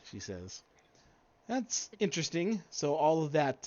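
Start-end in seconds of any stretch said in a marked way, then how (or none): 1.5-3.7 NPC voice. Acted. GM voices NPC, raised pitch.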